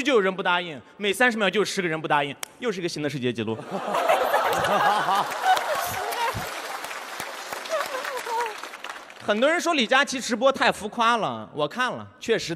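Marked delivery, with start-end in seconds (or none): 3.6-9.0 big roar of crowd laughter. Real, not acted. Audience reacts to joke.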